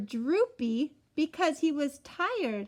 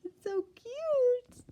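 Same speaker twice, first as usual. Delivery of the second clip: ordinary delivery, nothing marked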